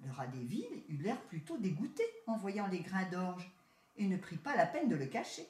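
ordinary delivery

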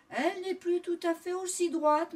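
plain reading voice

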